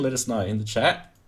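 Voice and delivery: usual speaking voice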